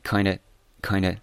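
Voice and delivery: kinda said as kine-duh, the v dropped; American kinda. Shown as a demonstration. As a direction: say kinda the relaxed American way, twice. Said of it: "可以直接读一个很美语化的kinda".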